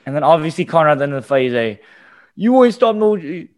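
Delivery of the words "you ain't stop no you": taunting fighter. Retold or imitated. Conor taunting at fight's end.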